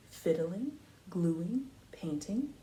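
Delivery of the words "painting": usual speaking voice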